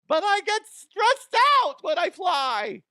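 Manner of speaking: high, slow whining complaint; mocking a nervous elderly flyer; mock whiny old lady. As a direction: whine like a fussy old lady.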